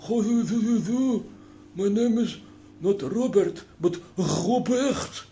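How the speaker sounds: high, loud, "How do you do?" slurred to "ho ju vu"; mocking mangled English. Comedian mocking slurred English after the plague, hammed-up accent. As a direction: mangle it like a comic foreigner. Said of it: "They say".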